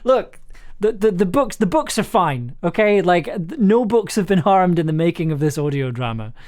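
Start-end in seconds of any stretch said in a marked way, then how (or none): none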